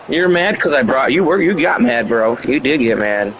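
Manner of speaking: ordinary talk, nothing marked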